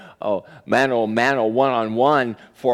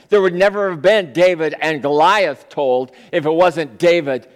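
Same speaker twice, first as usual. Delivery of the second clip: rising, louder sermon build; preaching at full force; 4 semitones higher, 4 dB louder than usual. Acted. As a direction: drive the story home to the congregation.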